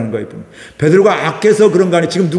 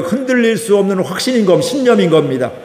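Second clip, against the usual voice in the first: ordinary talk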